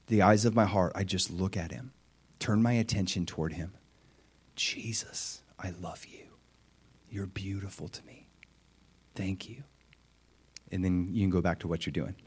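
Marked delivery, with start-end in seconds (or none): 4.5-9.6 whisper. Shown as a demonstration. Whispered prayer to Jesus.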